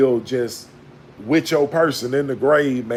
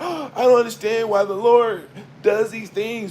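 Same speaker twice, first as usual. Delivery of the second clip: higher whiny mock confusion; mock bewildered whine; 7 semitones higher than usual. Mocking cowards who blame the Lord, higher mock whine. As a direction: whimper it like a spoiled believer.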